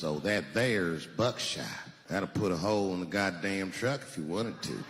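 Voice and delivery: low, drawn-out clerk voice; gun clerk. Acted. Clerk selling buckshot to buyer, low slow drawl. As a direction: take it low and slow, matter-of-fact.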